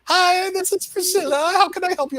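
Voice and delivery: high-pitched imitation South African accent; South African receptionist. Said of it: "You've got the best accent".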